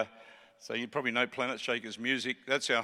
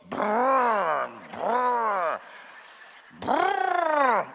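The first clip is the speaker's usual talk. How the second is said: trilled engine roar climbing higher; car engine revving; 7 semitones higher, much slower than usual. Retold or imitated. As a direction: rev a sports car with your lips, rising each time. Imitating the Camaro's engine while driving.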